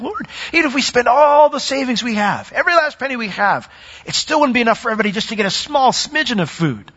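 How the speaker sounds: tally up the impossible sum in a worry; fretting disciple. Acted. Philip the numbers guy, voiced.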